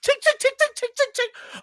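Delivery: high chinging tags sound; dog tag jingle imitation. Imitating the scratching dog's rattling tags. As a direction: mimic the rattling collar. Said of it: "dog tags are loud as hell".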